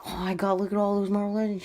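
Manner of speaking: loud, high, breakneck excitement; all excited. Retold or imitated. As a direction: blurt it out in overjoyed excitement. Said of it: "then I'm all excited".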